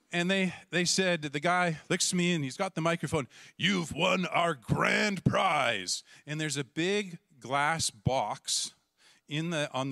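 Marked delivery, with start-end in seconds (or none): 3.6-6.0 very slow, drawn-out prize reveal; host announcing the grand prize; emcee announcement. Retold or imitated. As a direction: stretch it like a game show host. Emcee with microphone.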